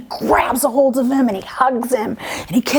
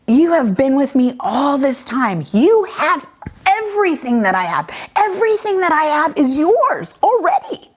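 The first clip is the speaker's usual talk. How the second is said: earnest father; 7 semitones higher than usual. Retold or imitated. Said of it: "the father looked at him and he said".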